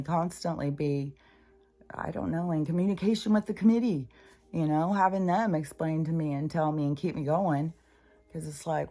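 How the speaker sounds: usual speaking voice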